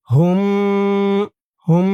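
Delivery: হুম with the m hummed long through the nose; nasal ghunna. Shown as a demonstration. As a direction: hold the closing m of হুম as a nasal hum. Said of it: "এখানে গুন্না হবে".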